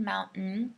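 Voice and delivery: mountain with the T swallowed; American mountain, softly; glottal mountain. Shown as a demonstration. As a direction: stop the T in mountain. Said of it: "same pronunciation".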